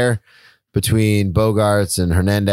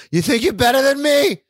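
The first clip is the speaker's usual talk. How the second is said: high mocking Boston tough-guy voice; Boston accent. Cohost doing a bad Boston accent to tease his friend. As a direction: put on a bad Boston accent, daring him. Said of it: "Such a bad accent".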